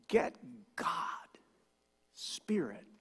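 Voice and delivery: hushed whisper on the key point. Real, not acted. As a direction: lean in and whisper it. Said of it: "whispered key point".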